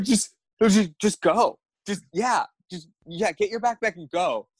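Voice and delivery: retold flustered, impatient order; exasperated dismissal. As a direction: wave him off, fed up. Exasperated, sending the attacker away.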